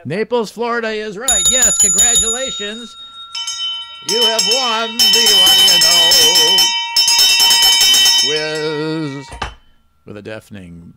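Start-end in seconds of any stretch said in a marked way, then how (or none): 0.0-9.3 excited announcer. Acted. Quiz host announces the winner.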